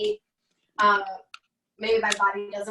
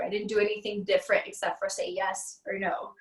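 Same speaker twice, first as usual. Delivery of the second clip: ordinary talk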